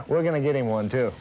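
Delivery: chuckling as he plays along; laughing. Real, not acted. Dealer jokes with host about a car, laughing.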